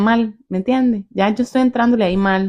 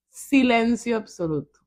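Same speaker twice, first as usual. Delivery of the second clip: normal talking voice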